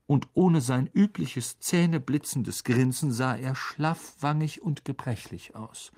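ordinary delivery

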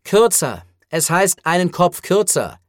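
exasperated correction. Acted. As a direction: correct them impatiently. Hero corrects the creature.

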